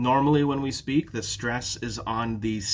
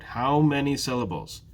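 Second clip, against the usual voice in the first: ordinary talk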